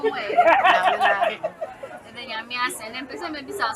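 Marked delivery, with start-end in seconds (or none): 0.0-1.4 laughing. Real, not acted. Host laughs through the word.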